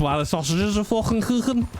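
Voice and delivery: high-pitched, hammed-up Scouse accent. Streamer slips into Scouse about his late-night cooking, hammed-up Scouse accent. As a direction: go broad Scouse, pitched well up. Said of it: "I don't know why I went scouse".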